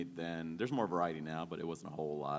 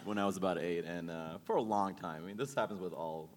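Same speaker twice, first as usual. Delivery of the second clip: ordinary delivery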